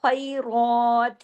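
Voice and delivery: slow खैरात, thick throaty kh and long raa; heavy, rasped kh. Shown as a demonstration. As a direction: scrape and stretch खैरात. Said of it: "खा मोटा और खराश के साथ". (खैरात, मोटा खा खराश के साथ)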